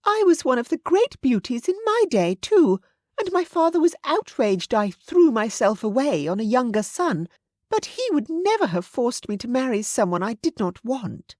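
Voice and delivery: high-pitched voice of a grand lady; proud aristocratic mother. A narrator's reading. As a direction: play an outspoken dowager, voice lifted high. Narrator voices Lady Helena, the mother.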